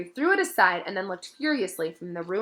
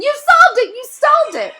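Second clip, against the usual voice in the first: much higher, much louder excited scream; screaming joy; 15 dB louder than usual. A narrator's reading. Hermione runs toward Harry, screaming with joy. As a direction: shriek it in delight.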